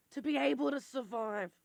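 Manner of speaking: usual speaking voice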